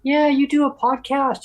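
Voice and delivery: exaggerated high-pitched politician; Dan Culture impression. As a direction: squeak it out in mock astonishment. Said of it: "And he goes".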